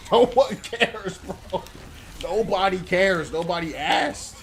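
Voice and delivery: slow, loud scornful jab with a laugh; mocking dismissal. Real, not acted. Laughing off the bragging teammate, slow and loud. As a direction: drag it out to mock him.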